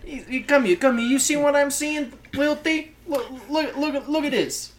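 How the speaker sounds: high, pestering New Jersey wise guy; New Jersey mobster. Acted. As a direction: beckon in a New Jersey accent. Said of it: "stereotypical, like, New Jersey's".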